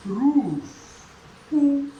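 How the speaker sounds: रूफ with a loud, drawn-out ऊ vowel; sounding out. Shown as a demonstration. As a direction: stretch the ऊ of रूफ loudly for learners. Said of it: "इसका वो करते हैं हम transcription".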